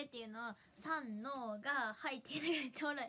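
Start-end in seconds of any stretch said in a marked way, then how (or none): 0.7-2.2 very slow, drawn-out count-in; Kokko's quirky count-in. Retold or imitated. Streamer imitating a friend's count-in, slow and quirky. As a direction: stretch each beat of the cue. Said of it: "コッコちゃん、 コッコちゃんが".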